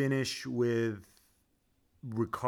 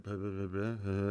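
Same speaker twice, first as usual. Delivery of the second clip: mumbled mock engineers; 6 semitones lower, 8 dB quieter than usual. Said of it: "They're like".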